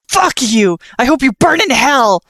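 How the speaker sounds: loud, high, hateful curse; furious cry. Acted. Nightmare cursing Frisk as trees fall, furious scream. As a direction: spit the curse out in rage. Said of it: "cried Nightmare".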